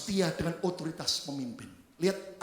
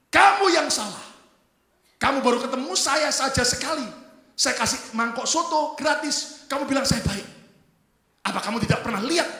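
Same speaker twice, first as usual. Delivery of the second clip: loud, raised reprimand; acted angry scolding; 9 semitones higher, 11 dB louder than usual. Soup seller scolding the runaway daughter, acted anger. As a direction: tell the young woman off forcefully. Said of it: "langsung marahin ini wanita".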